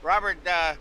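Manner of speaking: excited race call; motorsport commentator. Acted. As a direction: call the restart with energy. Live restart call.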